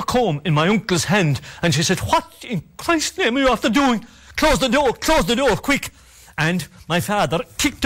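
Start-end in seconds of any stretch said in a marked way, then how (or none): 2.0-5.9 high, urgent, pressing commands; urgent alarm. Retold or imitated. Mother ordering her frightened family to close the door, high and urgent. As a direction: hurry them to shut the door.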